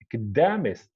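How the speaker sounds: "academist" wrongly stressed on DEM; misplaced stress. Shown as a demonstration. Teacher voicing a student's question, stress moved to DEM. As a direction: say "academist" as aca-DEM-ist, falling after DEM. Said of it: "put the stress on the syllable before the suffix".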